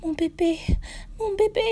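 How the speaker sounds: very high mumbled ghost voice; mumbling ghost. A narrator's reading. Ghost mother mumbling for her baby. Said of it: "elle ne peut que marmonner".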